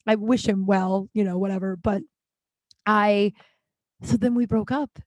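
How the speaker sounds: normal talking voice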